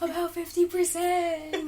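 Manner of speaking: high, slow mystical intoning. Retold or imitated. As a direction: say it like a spooky oracle. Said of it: "Like, very mystically".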